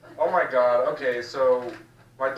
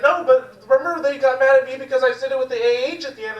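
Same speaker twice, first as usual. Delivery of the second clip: high, fast imitation of mom; protesting mom; 9 semitones higher, 4 dB louder, faster than usual. Comic as mom defending herself to a son, high hurried protest. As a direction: argue back quickly in a raised pitch. Mother arguing in story.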